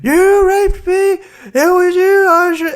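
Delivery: very high-pitched, hammed-up accusing wail; mock victim. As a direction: wail the accusation in a squeaky put-on voice. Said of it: "the victim being like".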